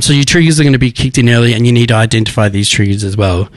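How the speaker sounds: rushed, fast delivery; speaking really quickly. Real, not acted. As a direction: race through it, short on time. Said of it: "I'm speaking really quickly".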